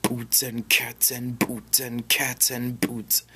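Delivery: a lighter take on the beat; gentle whispered boots and cats; watered-down beatbox. Shown as a demonstration. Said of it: "water that down per se".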